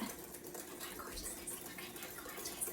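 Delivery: whispered marvelling at the food; hushed admiration. Real, not acted. Admiring the custard under breath.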